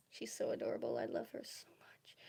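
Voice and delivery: soft breathy whisper about the cat. Real, not acted. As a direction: whisper it tenderly. Cooing about her cat.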